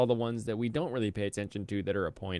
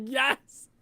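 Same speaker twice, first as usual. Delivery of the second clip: high, delighted squeal of triumph; proud high gloat at the commenter; gleeful; 5 dB louder, slower than usual. Retold or imitated. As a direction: crow it out gleefully. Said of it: "I was like".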